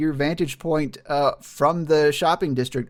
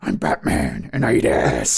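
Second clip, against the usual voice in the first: deep, gravelly Batman growl, low; 10 semitones lower than usual. Retold or imitated. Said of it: "it sounds like Batman".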